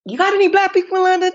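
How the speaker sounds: high, quick American questioner; mock American accent, fast and high; put-on American accent. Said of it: "apologize for my accent".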